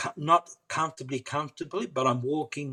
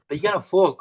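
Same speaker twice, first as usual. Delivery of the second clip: higher and louder warning; warning shout; 5 semitones higher, 7 dB louder, faster than usual. Retold or imitated. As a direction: call out the danger. Therapist warns in retold argument.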